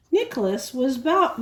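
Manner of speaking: usual speaking voice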